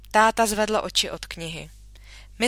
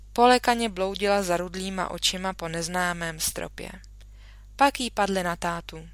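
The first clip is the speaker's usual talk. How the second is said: usual speaking voice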